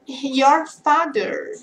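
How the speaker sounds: each word of "your father" drawn out slowly; very slow, spelled-out "your father". Shown as a demonstration. Teacher modelling "your father" very slowly for students. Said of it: "Você vai falar".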